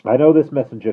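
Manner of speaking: calling out. Acted. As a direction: call to the guard. Passenger calling to guard.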